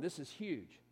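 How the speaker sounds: usual speaking voice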